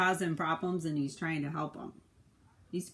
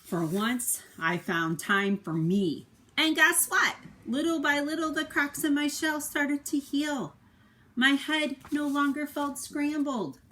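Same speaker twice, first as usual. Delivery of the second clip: bright, raised and slowed, happy turn; upbeat storybook reading; 11 semitones higher, 7 dB louder, slower than usual. A narrator's reading. Parent reading to a child, delighted storybook voice.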